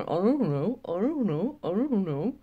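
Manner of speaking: sing-song mimicking of a fence-sitter; wavering fence-sitter impression, pitch rising and falling; mock indecisive. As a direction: dither in a repeated, swooping mock tone. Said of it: "standing in the middle of the road going".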